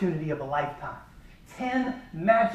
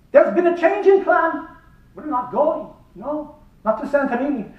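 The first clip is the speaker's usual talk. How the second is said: high, loud mock Greek captain announcing; ship captain; 7 semitones higher, 5 dB louder than usual. Greek captain telling restless passengers the trip is off, loud and high. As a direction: announce the bad news grandly.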